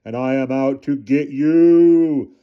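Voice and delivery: very slow, loud drawn-out boom; bellowing. Acted. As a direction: bellow it to terrify the boys. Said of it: "he bellowed".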